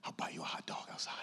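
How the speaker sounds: hushed whispered aside; sneaky whisper, just between us. Retold or imitated. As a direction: lean in and whisper it. Private aside to the woman.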